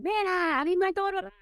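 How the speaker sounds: mother yelling. Retold or imitated. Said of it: "and yelling".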